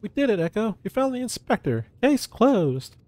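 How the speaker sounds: hammed-up high-pitched triumph; triumphant high voice. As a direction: cheer the solved case. Voicing triumphant companion character.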